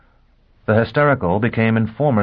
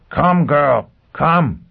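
urging call; 7 semitones higher, 5 dB louder, much slower than usual. Acted. Foyle urging her on.

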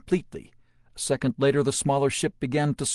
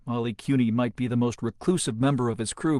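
plain reading voice

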